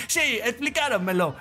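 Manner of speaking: put-on child replying with a touch of Galician accent; acento gallego. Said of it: "con un poco de acento también". (respuesta aguda y lenta con leve acento gallego)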